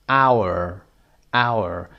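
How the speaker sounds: "hour" with no H sound, like "our"; silent H. Shown as a demonstration. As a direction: say "hour" starting on the vowel, H silent. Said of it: "اچش تلفظ نمیشه". («hour» بدون تلفظ h)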